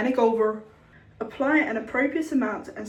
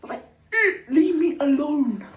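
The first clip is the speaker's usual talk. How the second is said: mock outburst; 4 semitones higher, 5 dB louder than usual. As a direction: vent at him in a hammed-up rant. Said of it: "I was like".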